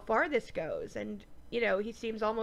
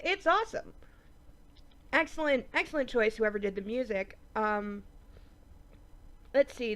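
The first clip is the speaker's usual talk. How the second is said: ordinary talk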